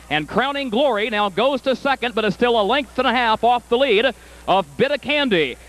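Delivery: brisk live race call rising to the finish; race caller. Acted. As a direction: call the race like a track announcer.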